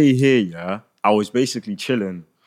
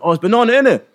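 imitating the stranger; 11 semitones higher than usual. Stranger at the underground guesses the milkshake, high mock voice. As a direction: squeak it out like the pushy man. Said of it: "he went".

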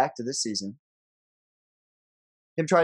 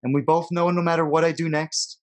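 dramatic poem reading. Acted. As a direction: read it like a farewell letter. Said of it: "You wanna read the poem for us".

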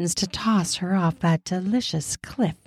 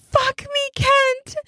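even higher, more desperate plea; whispered plea; slower than usual. Acted. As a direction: beg again, pushing the pitch up further. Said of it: "She whispers through the fabric".

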